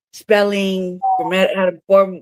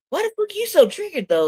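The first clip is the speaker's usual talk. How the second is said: high-pitched, baffled retold question; incredulous; 10 semitones higher than usual. Asking a friend why he's so worked up, incredulous.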